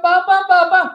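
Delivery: put-on high, loud child's shout; desperate child calling; shouting for papa. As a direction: yell for papa from far below. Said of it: "Tapos sumisigaw".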